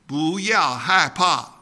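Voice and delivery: "bu yao hai pa" said slowly in Mandarin; Chinese phrase. Shown as a demonstration. Missionary teaching his congregation Chinese, slow careful Mandarin. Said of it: "it's time for a Chinese lesson".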